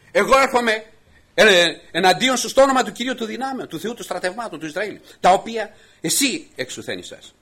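high, loud preached challenge; defiant proclamation. Acted. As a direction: proclaim it like a battle cry. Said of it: "Λέει ο, ο Δαβίδ".

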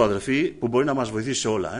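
ordinary delivery

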